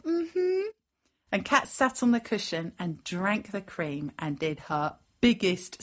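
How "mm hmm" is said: high hummed cat reply; contented cat. A narrator's reading. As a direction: hum a yes high, as a pampered cat. Cat answers the offer.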